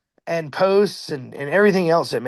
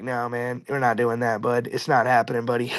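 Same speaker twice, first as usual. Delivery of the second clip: firm refusal; 6 semitones lower than usual. Retold or imitated. Telling Russ they won't pull it in, low and firm. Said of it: "And I'm like".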